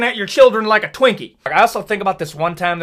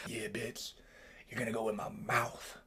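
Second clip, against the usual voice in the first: much lower, very soft menacing growl; menacing creature; 9 semitones lower, 25 dB quieter than usual. Acted. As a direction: murmur it like a lurking predator. Creature threatens people.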